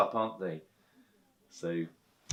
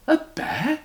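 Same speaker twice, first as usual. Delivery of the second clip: very high disbelieving question; amazed; 6 dB louder than usual. Acted. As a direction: squeak out your disbelief. Mrs Bird amazed.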